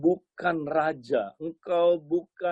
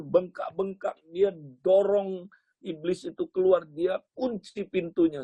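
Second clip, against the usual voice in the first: usual speaking voice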